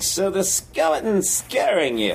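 a hint of a concerned dean, questioning; college dean. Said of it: "And the dean is like".

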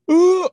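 high, loud "ooh"; imitated sudden whoop. Teller imitating a teammate's change from huffing, loud whoop. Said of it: "the huffing and puffing to".

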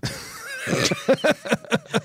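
high burst of laughter; loud laugh. Real, not acted. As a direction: crack up laughing.

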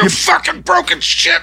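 high, strained pretend scream; hammed-up raging at a machine; mock screaming. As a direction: scream abuse at a car for laughs. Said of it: "Go scream at it".

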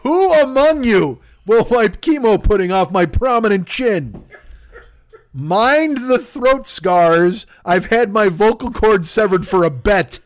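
slow, grand mock-tragic plea; hammed-up dying plea. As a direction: plead like a dying man on stage, hamming it up. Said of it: "put that chunk as my bio".

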